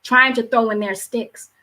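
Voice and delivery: impassioned spoken word delivery; spoken word poem. Acted. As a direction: declaim it like a slam poet, building in urgency.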